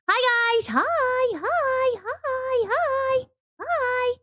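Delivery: high, bright repeated hellos; excited greeting. Real, not acted. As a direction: call out hi over and over. Greeting chat with excited calls.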